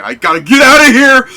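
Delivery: high, loud panicked yell; a huge tough guy shrieking to escape; panicked shout. Retold or imitated. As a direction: bolt in terror, shouting. Tough guy flees crying Vega.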